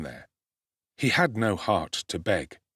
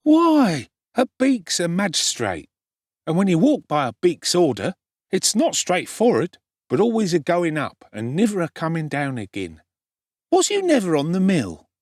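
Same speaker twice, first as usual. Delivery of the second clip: much higher and louder, brash know-it-all; swaggering street boy; 8 semitones higher, 5 dB louder than usual. A narrator's reading. Street boy explaining magistrates to Oliver, brash and loud. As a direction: lecture the greenhorn with swagger.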